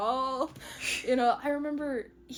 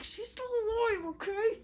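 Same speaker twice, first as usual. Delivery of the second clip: high, exasperated retort; hammed-up testy reassurance; mock defensive; 10 semitones higher than usual. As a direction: protest in a high put-on voice. Healer defending himself to mother.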